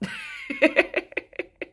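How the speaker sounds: a very high squealing laugh; high burst of laughter. Real, not acted. Streamer to chat about clearing the worms, a high squealing laugh. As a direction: let a shrill laugh burst out. Laughing at her own question.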